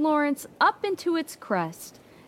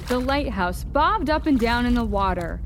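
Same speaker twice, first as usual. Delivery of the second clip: usual speaking voice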